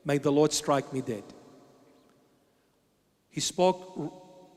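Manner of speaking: ordinary delivery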